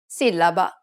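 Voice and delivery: "sillaba" said as a marked example word with its accented syllable stressed; Italian "sillaba", stress on the accented vowel. Shown as a demonstration. Teacher giving an example of written accents, stressed syllable. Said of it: "the second syllable needs to be stressed".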